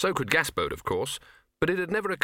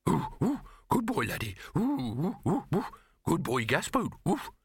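high, excited yips and woofs; barking dog voice; 6 semitones higher than usual. A narrator's reading. Chained Laddie perks up at Gaspode, excited barking. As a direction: yip the words like a simple dog.

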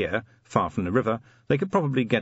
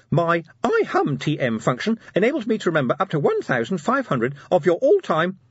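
high, loud, rapid salesy imp; pleading imp; 9 semitones higher, 4 dB louder than usual. Acted. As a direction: keep selling before the lid shuts.

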